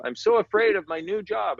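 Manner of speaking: imitating a student's fretful voice, pitched well up; high anxious student. A student in class frets about a new job, high and anxious. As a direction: play the nervous class member, high and worried. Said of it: "somebody in class go".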